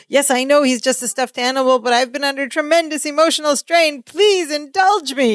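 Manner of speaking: high mock-emotional plea; Balki pleading. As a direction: beg way up high, dramatic. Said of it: "He goes".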